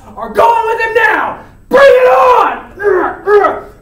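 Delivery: high, very loud war cry; all-out battle shout. Acted. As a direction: roar the challenge, stretching the last words. Challenge peaks before swordfight.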